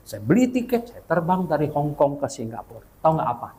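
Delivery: usual speaking voice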